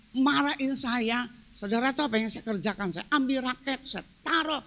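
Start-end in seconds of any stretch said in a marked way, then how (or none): none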